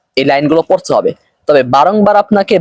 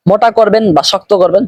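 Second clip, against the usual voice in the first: ordinary talk, nothing marked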